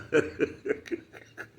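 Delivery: high wheezing laughter; breathy laugh. Real, not acted. Cracking up at his own joke, breathy and high. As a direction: let the laugh break out.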